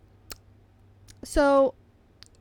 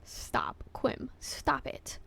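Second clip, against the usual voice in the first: whispering; 8 dB quieter, much slower than usual. Real, not acted. Whispered to friend.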